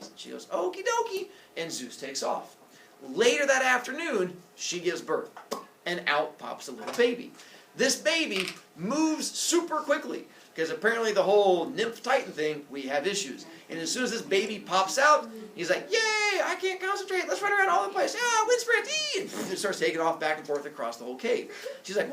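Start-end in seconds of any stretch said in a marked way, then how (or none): 0.5-1.3 squeaky-high, chirpy agreement; high singsong yes; cheery mother voice. Acted. Maya cheerfully agrees to hide. 15.9-19.2 put-on very high, squealing baby voice; high, loud, manic little-kid glee; hyperactive baby. Hyperactive newborn runs around.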